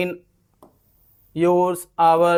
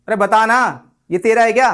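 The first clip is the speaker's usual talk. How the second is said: louder, higher, pushy "ये तेरा है क्या"; cocky demanding; 4 semitones higher, 6 dB louder than usual. Shown as a demonstration. Teacher demanding whose pen it is, louder and cocky. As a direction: push him to answer with swagger. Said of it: "अकड़ के पूछ रहे हैं".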